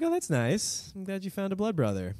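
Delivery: lightly voiced mom. Mom answering news of a blood brother, lightly mimicked approval. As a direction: put on a hint of a sweet mom. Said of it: "She's like".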